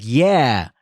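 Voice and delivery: normal talking voice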